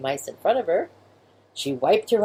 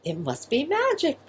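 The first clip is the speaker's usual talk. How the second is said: excited; 8 semitones higher, 3 dB quieter than usual. Acted. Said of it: "cried out, excited".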